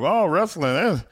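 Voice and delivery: high, sing-song mock voice of skeptics; mocking wrestling doubters. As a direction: sneer it up high like a know-it-all fan. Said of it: "And people always talk".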